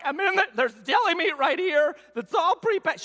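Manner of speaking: high, laughing disbelief, pitch jumping up; exasperated protest. Retold or imitated. Frustrated call with wife.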